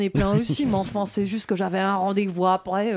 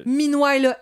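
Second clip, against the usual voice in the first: English with a light Italian lilt; mock Italian-accented English; Italian-sounding English; 3 semitones higher, 4 dB louder, slower than usual. Trying the Marseille accent on English, Italian-sounding English. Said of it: "ça ressemble à un accent italien en anglais".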